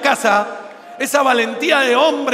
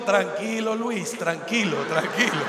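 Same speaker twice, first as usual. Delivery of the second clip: soothing doctor; 4 semitones lower, 6 dB quieter than usual. Retold or imitated. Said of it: "y el médico".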